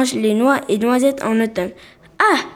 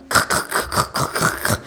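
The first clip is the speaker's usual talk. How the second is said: gnawing noise; 4 dB quieter than usual. Retold or imitated. Squirrel gnawing noise imitated.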